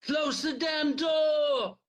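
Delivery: high, slow scream. A narrator's reading. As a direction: drag out each word in desperate fury. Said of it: "Tuck screamed".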